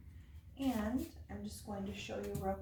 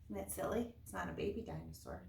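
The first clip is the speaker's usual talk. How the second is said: ordinary talk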